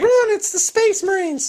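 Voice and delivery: shrill high panicked cry; hammed-up fleeing in terror; mock panic. Mock panic at Space Marines.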